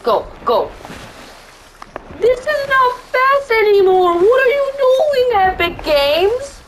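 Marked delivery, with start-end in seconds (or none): 2.2-6.6 squealing disbelief; high-pitched outrage. Real, not acted. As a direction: shriek at the game. Outraged complaint.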